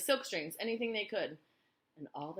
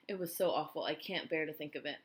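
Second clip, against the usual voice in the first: plain reading voice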